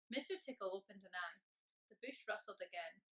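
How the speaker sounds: ordinary delivery, nothing marked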